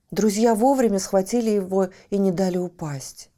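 plain reading voice

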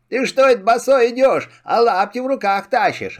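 high, quick mocking guests. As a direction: tease him as the guests laughing at him. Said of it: "смеются".

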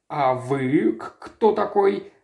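drawn-out stutter on the first words; flustered, halting stammer. A narrator's reading. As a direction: stumble on the k, then get it out. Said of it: "заикаясь, произнёс доктор".

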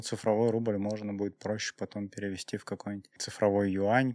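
ordinary talk

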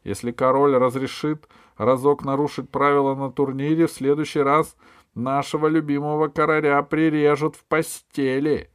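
insistent, high and loud argument; objecting. A narrator's reading. Jester warns about breaking tournament rules, objecting insistently. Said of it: "возразил шут".

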